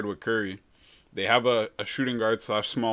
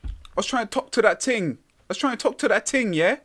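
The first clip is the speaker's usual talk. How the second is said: mock UK accent; 10 semitones higher than usual. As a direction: ham up a British street accent. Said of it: "That's my U K accent".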